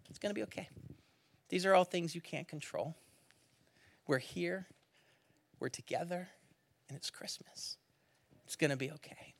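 soft, hushed reassurance; reassuring. Retold or imitated. Wife soothing her frustrated husband on a stalled train, soft and reassuring. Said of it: "looking at me and saying".

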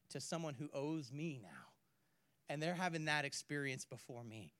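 ordinary delivery

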